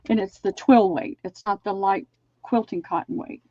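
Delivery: ordinary talk, nothing marked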